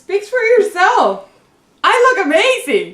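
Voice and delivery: loud, sassy brag; sassy drag queen. Acted. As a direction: strut it, full diva attitude. Sassy self-praise.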